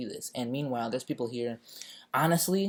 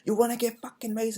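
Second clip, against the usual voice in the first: high, snarling threat from a cop, pitched way up; hammed-up furious cop warning; angry cop; 10 semitones higher than usual. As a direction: bark it like a riot cop about to spray. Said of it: "He's like".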